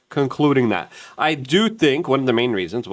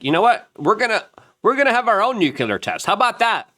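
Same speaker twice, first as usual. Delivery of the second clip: mock Trump; 11 semitones higher than usual. Trump answering the Russians, higher mock dare. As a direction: throw it back like a dare, pitched up. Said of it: "And Trump's like".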